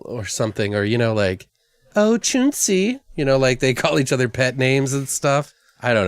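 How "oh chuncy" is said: high, slow, syrupy rich-wife coo; mock pet-name coo. Rich wife cooing her husband's pet name, high and slow.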